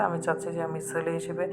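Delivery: usual speaking voice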